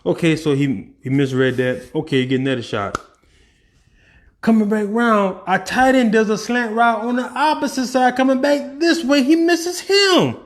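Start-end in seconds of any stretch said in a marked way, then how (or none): 4.4-10.4 rising higher and higher as the play unfolds; excited replay. Retold or imitated. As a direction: call the play like you're reliving it, climbing to a peak. Reliving the missed play excitedly.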